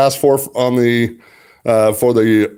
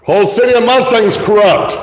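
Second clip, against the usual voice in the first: loud, higher, furious rant at the city; real fury spilling over after the call; real angry outburst; 9 semitones higher, 4 dB louder than usual. Real, not acted. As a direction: let the anger burst out loud and high. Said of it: "sorry I'm getting upset".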